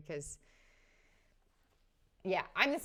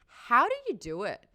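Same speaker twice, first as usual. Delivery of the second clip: loud, raised incredulous question; incredulous Zoya; 6 semitones higher, 11 dB louder than usual. Acted. Baffled woman asking a politician his secret, incredulous. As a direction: demand it in loud disbelief. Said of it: "Zoya's talking to him, being like".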